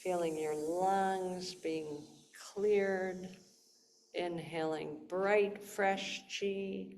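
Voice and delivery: soft, slow breathwork voice; guided meditation. Shown as a demonstration. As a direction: guide them gently into the breath.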